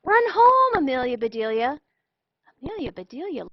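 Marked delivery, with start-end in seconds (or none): 0.0-1.8 very loud, high single-word yell; shouted. Acted. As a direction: bellow it across the diamond. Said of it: "shouted the boys".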